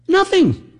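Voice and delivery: a sudden high squeak, then a fall; mock high dismissive answer. Host answering his own question, mock high and dismissive. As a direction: play the dismissive know-it-all.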